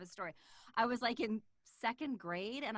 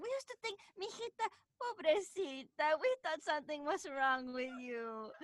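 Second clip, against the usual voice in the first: grandma's voice; 5 semitones higher, 4 dB louder than usual. Retold or imitated. Granddaughter imitating her grandma's doting worry. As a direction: coo it like a worried abuela. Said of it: "my grandma's like".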